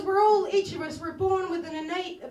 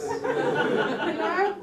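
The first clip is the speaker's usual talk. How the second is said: ordinary talk, nothing marked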